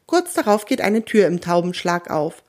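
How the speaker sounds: usual speaking voice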